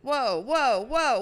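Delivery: loud startled whoa; surprised whoa. Real, not acted. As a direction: gasp at the chaotic play. Wild pass on court.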